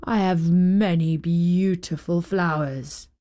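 low, lightly voiced old giant. A narrator's reading. Old giant admiring his garden, lightly voiced and low.

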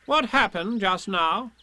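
slow, high, important voice; solemn Rabbit. A narrator's reading. Rabbit questioning Tigger, slow and solemn. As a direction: draw it out with self-importance. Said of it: "he said solemnly".

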